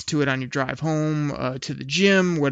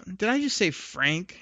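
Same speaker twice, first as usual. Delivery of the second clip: ordinary talk, nothing marked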